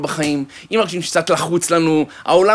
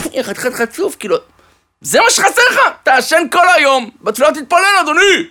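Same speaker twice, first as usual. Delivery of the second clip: high, loud scolding rabbi; angry rebuke; 10 semitones higher, 3 dB louder than usual. Acted. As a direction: tear into the cheeky student. (הרב נוזף בתלמיד בכעס ובקול רם)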